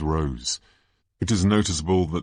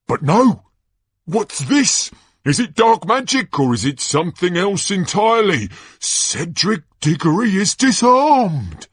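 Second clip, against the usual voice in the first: high, loud disbelief; gasping questions into a shouted verdict; Ludo Bagman, astonished commentator; 14 semitones higher, 7 dB louder than usual. Acted. Announcer reacting to the champion losing his wand, shocked and loud.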